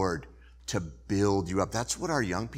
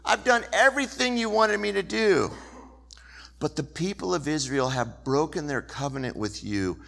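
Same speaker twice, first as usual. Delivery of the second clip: pleading complaint; 6 semitones higher, 3 dB louder than usual. Acted. Elijah pleading his case.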